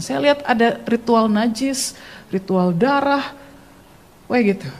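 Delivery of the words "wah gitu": ordinary talk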